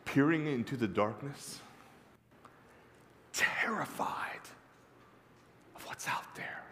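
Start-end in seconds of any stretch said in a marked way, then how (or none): 3.3-6.6 terrified whisper. Acted. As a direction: whisper it as if afraid of the dark. Evoking terror of the dark.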